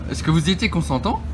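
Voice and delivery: ordinary talk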